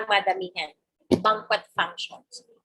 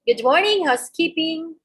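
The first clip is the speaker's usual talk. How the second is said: higher, louder sing-out at the door; housekeeper's call; 7 semitones higher, 4 dB louder than usual. Acted. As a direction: call out to a guest behind the door. Acts out housekeeper calling at guest's door.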